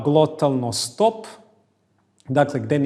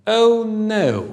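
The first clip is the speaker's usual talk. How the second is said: mock dismay; 6 semitones higher, 7 dB louder than usual. Dialogue reaction.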